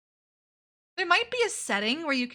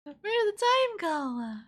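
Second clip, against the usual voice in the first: higher, slow, drawn-out where did the time go; milk the line theatrically; exaggerated wistful whine; dramatic mock dismay; 9 semitones higher, 4 dB louder, slower than usual. Repeating the quote dramatically.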